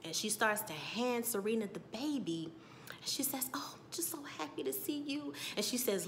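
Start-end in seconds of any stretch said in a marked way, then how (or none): 3.5-5.3 soft, higher imitated greeting; tender greeting. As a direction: coo it gently, voicing a happy mother. Acting out Serena greeting baby.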